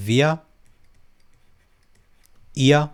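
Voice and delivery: ordinary talk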